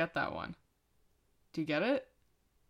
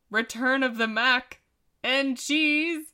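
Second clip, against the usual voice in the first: usual speaking voice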